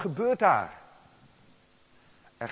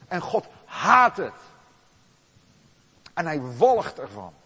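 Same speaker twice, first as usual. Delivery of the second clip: loud, rising, hammering list; preaching at full force; 6 semitones higher, 9 dB louder than usual. Acted. Preacher denouncing every sin God sees, at full force. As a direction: build each phrase louder like a blow. Sermon, climactic list of sins.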